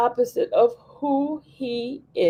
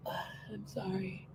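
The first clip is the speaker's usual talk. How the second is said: sigh, then whisper it much softer and slowly; 3 semitones lower, 19 dB quieter, slower than usual. Real, not acted. Apologizing to the viewers, a slow sighing whisper.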